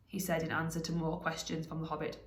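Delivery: ordinary delivery, nothing marked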